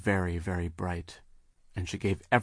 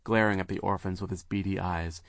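ordinary delivery, nothing marked

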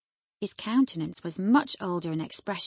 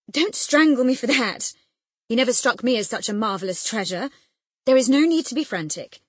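loud, higher-pitched, irritated protest; cross; 6 semitones higher, 10 dB louder than usual. A narrator's reading. Husband fends off his overexcited wife's embrace, cross. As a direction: scold her crossly, voice raised. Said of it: "cried her husband crossly".

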